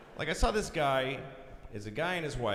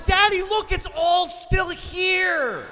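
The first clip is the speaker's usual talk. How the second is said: put-on excited child; 8 dB louder, much slower than usual. Child voice at dawn.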